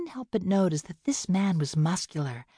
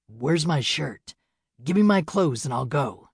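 weak injured man; 2 semitones lower, 3 dB louder than usual. Acted. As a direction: struggle to sound firm through the pain. Injured man demands clothes.

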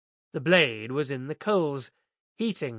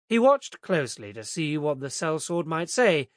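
plain reading voice

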